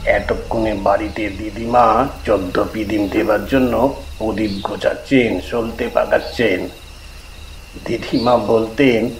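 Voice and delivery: ordinary delivery, nothing marked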